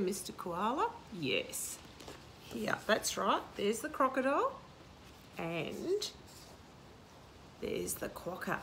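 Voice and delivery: usual speaking voice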